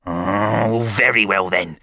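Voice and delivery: loud, grudging snap; irritated father relenting loudly; snapped. A narrator's reading. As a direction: give in with bad grace. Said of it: "he snapped".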